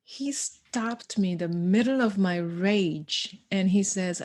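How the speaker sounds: ordinary talk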